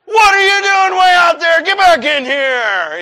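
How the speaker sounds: high, full-voiced scolding shout; yelling lifeguard. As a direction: yell it across the water at a swimmer far out. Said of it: "He yelled at me".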